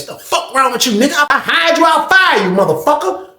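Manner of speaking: high-pitched retold tirade; cussing him out. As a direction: go in on him without letup.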